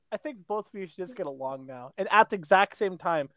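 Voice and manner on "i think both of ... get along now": much softer, calm smoothing-over voice; quietly playing the peacemaker; soft mock peacemaker. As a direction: hush them like a mock mediator. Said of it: "it was like this".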